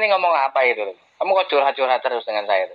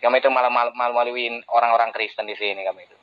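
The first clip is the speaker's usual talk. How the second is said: ordinary talk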